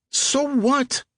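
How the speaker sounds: put-on pushy teenage getaway driver; impatient teen driver. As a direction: snap at him from the driver's seat. Driver brushes off the bus.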